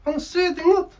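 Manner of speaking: high, loud puppet voice; cheeky bird puppet voice; squeaky puppet bird. Acted.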